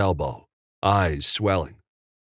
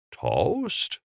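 drawn-out "Toast?" rising high at the end; drawled; 7 semitones higher, 4 dB quieter than usual. A narrator's reading. Jimmy puzzled by Evan's slang, drawling. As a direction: stretch "Toast?" into a puzzled question. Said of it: "Jimmy drawled out".

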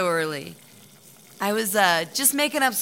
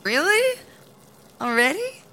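high rising questions, a little louder; surprised; 8 semitones higher, 4 dB louder than usual. Acted. Act caught off guard by the news. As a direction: tenant told it is rent day, surprised. Tenant feigns surprise at rent day.

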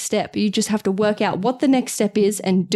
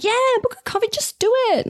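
high-pitched mock pep talk; imitating an overeager advice-giver; breezy podcast advice; 12 semitones higher, faster than usual. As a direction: parody your own chirpy advice, pitched way up. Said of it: "gets on this podcast and is like".

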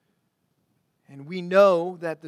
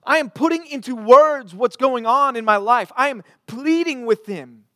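higher, louder pleading cry; David calling out; 7 semitones higher, 7 dB louder than usual. Retold or imitated. Preacher voices David crying out to God, loud and pleading. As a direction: call out to the Lord as David, pleading. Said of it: "saying, ... calling out to the Lord".

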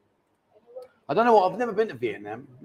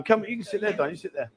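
normal talking voice

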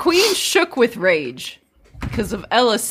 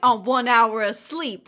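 ordinary talk

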